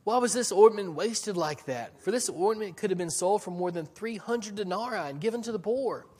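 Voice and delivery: indignant. Retold or imitated. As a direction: imitate the disciples' outrage at the waste. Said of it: "said to themselves indignantly".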